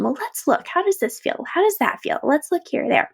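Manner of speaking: gently coax, like a father looking at a scraped knee; dad's gentle questions. Retold or imitated. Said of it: "and helped investigate".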